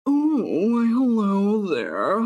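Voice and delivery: hammed-up man, very drawn out; exaggerated man. Player voicing a gameplay character, exaggerated drawn-out man voice. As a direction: stretch the greeting out in a caricature male voice. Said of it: "some just really exaggerated man".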